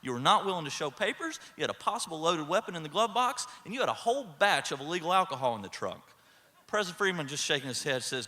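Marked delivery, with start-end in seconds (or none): 0.0-6.0 imitating baffled disbelief, raised pitch; higher voice, listing each charge incredulously; completely puzzled. Senior cop confronting the president with rookie's claims, baffled. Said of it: "senior cop is completely puzzled".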